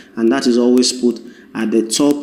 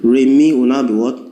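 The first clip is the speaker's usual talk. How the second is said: ordinary delivery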